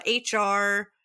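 ordinary talk

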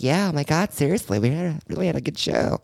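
mock a giddy excited gushing; gushing valley girl voice. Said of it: "if I actually sounded like that".

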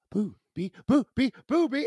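put-on a small child's panic starting to build; imitating alarmed toddler. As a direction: repeat it haltingly, pitch and urgency rising. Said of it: "And she goes".

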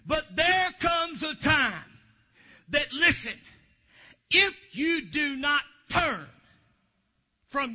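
loud, slow warning from the pulpit; preaching at full force. Acted. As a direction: drive the warning home.

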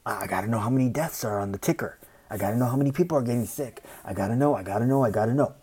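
fast, urgent rush of words; mock anxious news junkie. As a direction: rattle it off like someone glued to the ticker. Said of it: "People tuning in".

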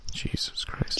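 whisper. Real, not acted. Muttered aside after mic trouble.

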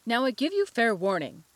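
shouting. A narrator's reading. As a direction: bark out the warning. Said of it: "shouted the Queen".

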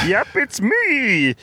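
high, slow, drawn-out goofy answer; Michael impression. Podcaster answering as the absent friend in the car, high impression voice. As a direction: answer in a stretched, high put-on voice. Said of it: "Michael, are you in the car".

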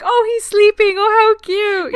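high, loud cooing squeal; cooing over baby. Retold or imitated. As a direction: squeal like watching a newborn.

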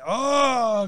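high-pitched exclamation; real excitement. Real, not acted. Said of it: "I get so excited".

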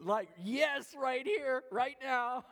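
loud, high, laughing insistence; high-pitched, punchy enthusiasm; mock eager. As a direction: burst out with an eager yes. Acts out eager reply, laughing.

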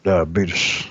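put-on deep drawn-out old man; low, slow diabetes ad read; Wilford Brimley voice. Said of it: "Walter Brimley".

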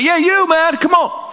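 high American shout across court; American accent. Acted. Player confirming he means the visitor, American accent. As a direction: impatiently beckon in a put-on American accent. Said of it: "That's my American accent".

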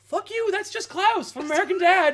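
loud, high-pitched comic outrage; outraged. Acted. Podcaster calling out a lazy voice role, comically outraged. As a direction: yell it in mock fury, voice shooting up. Acted outraged reaction to the casting.

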